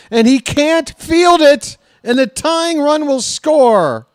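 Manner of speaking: high, loud cry of alarm; real shouted horror at the play. Real, not acted. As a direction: call the disaster at full voice. Tying run scores against his team.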